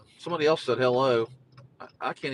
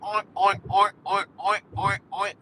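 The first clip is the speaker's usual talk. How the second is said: higher oink oink imitation; pig oinks; 5 semitones higher, 3 dB louder than usual. Trying goose noise but oinking like a pig, mock oinks. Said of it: "No, that's a pig".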